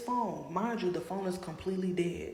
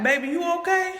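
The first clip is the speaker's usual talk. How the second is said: put-on much higher and louder, a concerned older lady calling out; caring lady; 12 semitones higher, 8 dB louder than usual. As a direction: call out sweetly like a worried auntie. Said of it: "she like".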